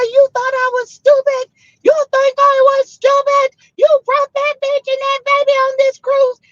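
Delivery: shrill-pitched outrage at him, voice way up; furious wife. Retold or imitated. Wife screaming at her cheating husband: high, furious.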